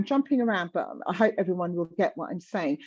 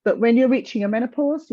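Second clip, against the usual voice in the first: ordinary delivery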